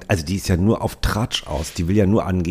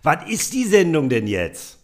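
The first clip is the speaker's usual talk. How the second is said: very high, gushing mother voice; shrill-free but sky-high chatty mum showing off; proud mother on the phone; 8 semitones higher, 3 dB louder than usual. Acted. Podcaster reads the mother's lines bragging to Waltraud, in a very high put-on voice. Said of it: "Du bist Mutter".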